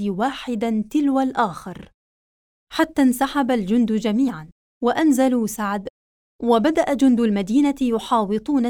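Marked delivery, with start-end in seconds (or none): none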